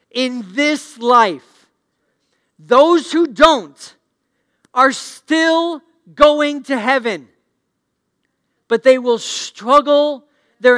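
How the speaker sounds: loud, high, slow preaching; full-force preaching. Acted. Pastor preaching to the congregation at full force, loud and drawn out. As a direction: preach it out to the whole room.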